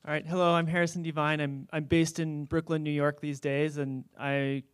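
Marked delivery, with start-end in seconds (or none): none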